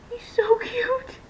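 high, drawn-out gushing coo; adoring coo. Real, not acted. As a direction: coo over something adorably cute. Gushing over the new doll.

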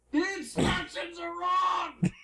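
high, drawn-out exasperated yell; shouted protest. Acted. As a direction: yell it slowly, high-pitched, in defence. Acted interrogation, protesting back.

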